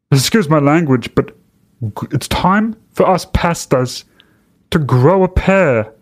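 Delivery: mock blustery pastor, pitched high; Rodney Howard-Browne. Host parodying the pastor addressing fellow pastors, mock blustery. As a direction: imitate a blustering preacher in a put-on accent, halting between phrases. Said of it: "But this fucking blustery".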